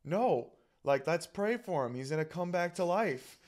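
mock-indignant pleading with a parent; retold getting mad. Angry kid insisting mom pray for the dead lizard, indignant. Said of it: "getting so mad".

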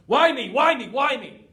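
a hammed-up loud why-me wail; angry outburst. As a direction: throw it up at the sky, fed up. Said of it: "The second is when you're angry".

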